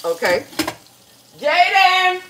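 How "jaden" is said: high loud call across the house; real holler, higher; hollering for son. Real, not acted. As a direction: holler for Jaden from the kitchen. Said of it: "Sorry, y'all, for hollering".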